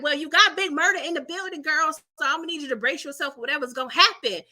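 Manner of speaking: fast, teasing reply; fast quip. Retold or imitated. Teasing Clifford to brace herself, fast and playful. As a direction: rattle it off quickly. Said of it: "I was like".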